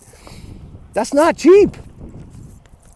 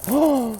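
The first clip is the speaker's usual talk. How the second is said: sudden sharp intake of breath; quick shocked breath; startled gasp; 3 semitones higher, 7 dB quieter than usual. Real, not acted. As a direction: gasp in alarm. Dog breaks her collar.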